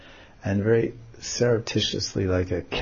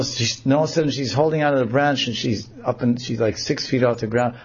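ordinary delivery, nothing marked

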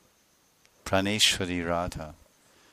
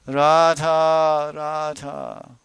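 high, drawn-out lament calling Radha; crying in separation; 7 semitones higher, 7 dB louder, much slower than usual. Acted. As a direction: cry out her name in longing. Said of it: "he cries in separation".

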